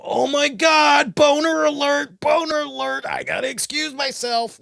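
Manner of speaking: mock panicked outburst. Man mimicking the panic he never felt around her, mock alarm. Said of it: "It didn't make me go".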